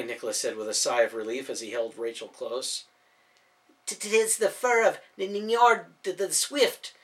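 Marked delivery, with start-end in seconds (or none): 3.9-6.9 put-on higher, louder boastful young voice; Daniel proudly explaining the cloak's fur; proud proclamation. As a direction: announce it proudly, stumbling over the name. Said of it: "Daniel proudly proclaimed".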